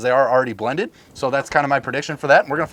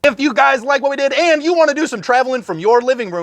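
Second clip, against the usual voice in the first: announcer outro; 8 semitones higher than usual. Acted. Hosts wrapping up the episode for viewers, big announcer sell. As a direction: sell it like a TV pitchman.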